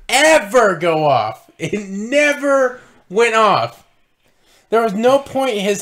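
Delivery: "ever" and "never" drawn out high; emphatic exasperation. Real, not acted. Host ranting that the gun never fired, emphatic exasperation. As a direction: hammer "never" slowly in disbelief.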